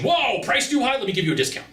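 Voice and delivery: mock panic, pitch jumping up on whoa; hammed-up salesperson flinching at a price objection; alarmed salesperson. Salesperson panicking at a price objection, mock alarm. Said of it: "have an instant allergic reaction".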